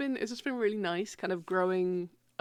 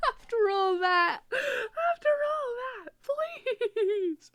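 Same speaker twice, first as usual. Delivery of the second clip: high, slow laughing wail; laugh-crying; 14 semitones higher, much slower than usual. Real, not acted. Begging the chat after all that, laughing high. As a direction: whimper through laughter.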